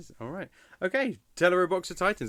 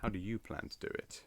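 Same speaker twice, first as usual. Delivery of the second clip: stern board member; 7 semitones lower, 7 dB quieter than usual. Pretend. Roleplaying Nintendo's board questioning John.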